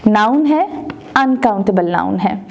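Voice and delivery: ordinary talk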